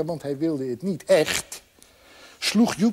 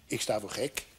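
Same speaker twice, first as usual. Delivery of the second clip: low, hushed aside; dropped, quiet inner voice; private thought; 7 semitones lower, 11 dB quieter than usual. A narrator's reading. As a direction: think it aloud, very softly. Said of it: "schoot er door hem heen".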